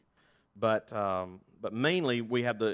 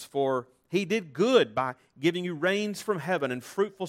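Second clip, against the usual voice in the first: higher, slower, louder proclaiming plea; crying out; 5 semitones higher, 5 dB louder, slower than usual. A narrator's reading. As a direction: cry out to the crowd, pleading them to stop. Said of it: "crying out".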